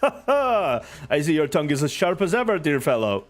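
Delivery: slow, chuckling knightly reply; laughing. Acted. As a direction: laugh through the words. Siegfried laughs while answering.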